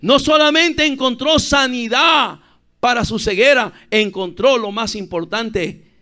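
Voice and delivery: loud, high start, slowly stretched; emphatic preaching. Real, not acted. Preacher proclaiming what the blind man found, emphatic preaching.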